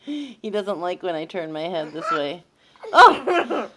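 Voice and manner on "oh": sudden shrieking laugh; being tickled. Real, not acted. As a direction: yelp then burst laughing.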